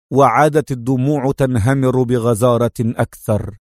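ordinary delivery

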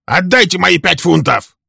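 high, loud demand. A narrator's reading. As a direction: Walter demanding his money, angry. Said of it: "требовал Вальтер".

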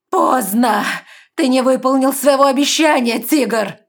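high, loud furious shout; angry growl. A narrator's reading. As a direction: roar it in rage. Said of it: "прорычала амазонка".

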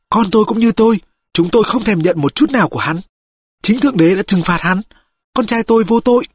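high woman's voice, proud and indignant; defiant, bitter mother. Acted. (giọng người mẹ cao, phẫn nộ bênh con)

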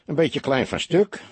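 somewhat distrustful. A narrator's reading. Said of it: "zei hij wat wantrouwend". (wat wantrouwend)

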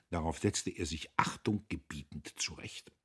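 usual speaking voice

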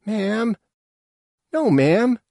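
high, loud protest; high-pitched dismayed objection; alarmed youngster. A narrator's reading. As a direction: plead with your mother in a high young voice. Youngster protests to angry mother.

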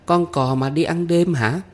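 put-on high, curious child voice; young girl's high questioning voice; guessing little girl. A narrator's reading. Child guesses which rhyme.

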